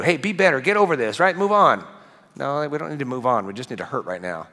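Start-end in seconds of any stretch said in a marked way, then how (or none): 0.0-1.8 higher, louder mock push to get over it; brisk, dismissive cheerleading; mock pushy encouragement. Preacher mocking churchgoers telling a sufferer to move on. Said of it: "a very religious thing to do".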